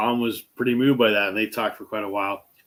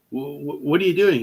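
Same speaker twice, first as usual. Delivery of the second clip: stammering, rising higher in mock disbelief; surprised stammer; 5 semitones higher than usual. Fan stunned as the star writes his number, mock surprise, higher. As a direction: stutter then jump up in astonishment. Retelling the guy's astonished reaction.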